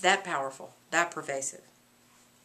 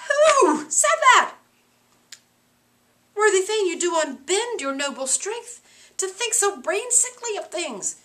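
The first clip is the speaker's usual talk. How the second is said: scornful Lady Macbeth; 8 semitones higher, 7 dB louder than usual. Acted. Lady Macbeth rebuking her husband, loud and high. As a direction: rebuke him sharply.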